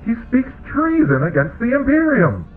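very high, loud squeal of outrage; shrieked accusation. A narrator's reading. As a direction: denounce him at a shriek. Squealing herald accuses Eris of treason.

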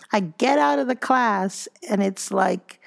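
ordinary talk, nothing marked

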